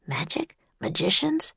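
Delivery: soft inner-voice questions; thinking aloud. Acted. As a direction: muse it to yourself quietly. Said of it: "Jack thought".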